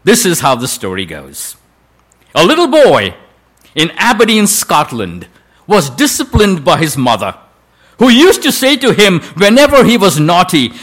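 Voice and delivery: ordinary delivery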